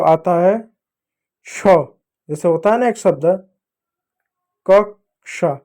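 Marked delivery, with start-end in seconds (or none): 4.7-5.6 कक्षा split into "kak - sha"; syllable-split pronunciation. Shown as a demonstration. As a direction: pause inside कक्षा, clear "sha". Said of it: "कक्षा पढ़ते हैं".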